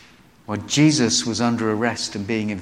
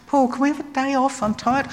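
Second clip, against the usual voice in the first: much higher, pleading whine; whiny young Mark; 14 semitones higher than usual. Retold or imitated. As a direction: beg for a break like a tired kid.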